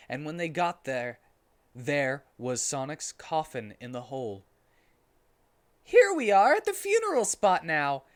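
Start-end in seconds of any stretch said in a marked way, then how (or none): 5.8-8.0 put-on high, louder boyish voice; Tails' voice. A narrator's reading. Tails announcing arrival to everyone, high-pitched and eager. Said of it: "said Tails to everyone".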